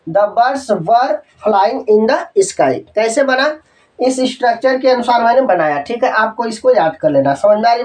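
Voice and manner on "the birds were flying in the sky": very slow, word by word so pupils can copy; slow dictation. Shown as a demonstration. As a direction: dictate it slowly to the class. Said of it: "मैं आपके बताना चाहूँगा". (बहुत धीमे, शब्द-शब्द लिखवाते हुए)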